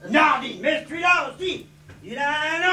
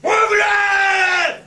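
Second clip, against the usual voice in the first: loud, higher, dragged-out challenge; slow shout; 6 semitones higher, 7 dB louder, much slower than usual. Acted. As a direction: bellow each word slowly. Drunk defies doctors. (crier lentement)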